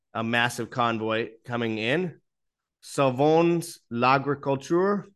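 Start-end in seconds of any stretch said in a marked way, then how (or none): 2.8-5.1 slow, careful attempt at a French accent. Shown as a demonstration. Reading a French farmers' protest slogan aloud in a self-admitted poor French accent. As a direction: sound it out in a shaky, amateur French accent. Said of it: "my French accent isn't good".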